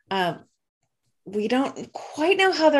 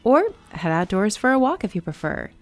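smooth host intro over music; welcoming announcer read; podcast intro read. Acted.

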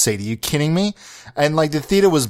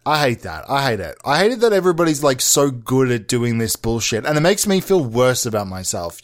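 ordinary delivery

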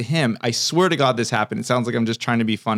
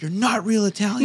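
much higher, laughing mock voice; mocking coworkers; 8 semitones higher than usual. Waiter imitating Italian coworkers mocking him, high and laughing. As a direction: sneer it in a laughing high put-on voice. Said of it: "they'd be like".

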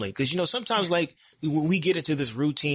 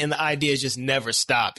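usual speaking voice

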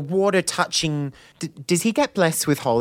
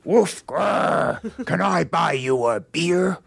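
growly come-on from a camp bear; growl, then chat someone up as a bear; wild bear; 5 semitones higher, slower than usual. Acted. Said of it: "I will be doing a wild bear".

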